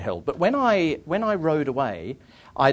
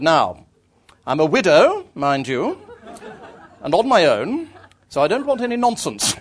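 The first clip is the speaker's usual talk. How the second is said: loud, higher, firm warning; imitated no-nonsense lady, in measured phrases; stern countrywoman; 6 semitones higher, 7 dB louder than usual. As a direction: lay down the law like a country widow. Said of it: "fixed me with a sort of gimlety eye".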